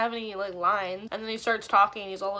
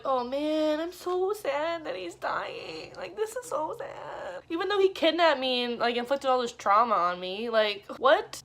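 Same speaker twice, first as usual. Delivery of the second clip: lightly voiced sappy girl, much higher; mock sad girl; 5 semitones higher than usual. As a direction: parody her fake sorrow. Mocking character's sad reaction.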